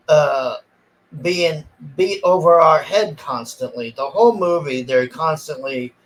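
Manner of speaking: usual speaking voice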